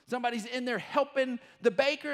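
ordinary talk, nothing marked

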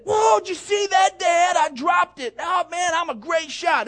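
high, loud boastful whoop; bragging kid. Retold or imitated. Imagined boy bragging to his dad, high and loud. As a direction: brag like a kid after a lucky shot. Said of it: "it wasn't".

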